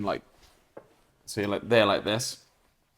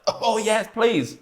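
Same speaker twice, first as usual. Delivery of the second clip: mock eager; 11 semitones higher, much slower than usual. Mimicking eager strangers.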